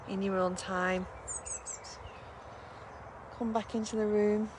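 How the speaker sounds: calm voice closing a relaxation; guided meditation. Acted. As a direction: gently guide them back into the room.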